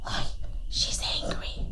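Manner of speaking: whispered warning; hushed aside. A narrator's reading. Hushed aside about angry iguana.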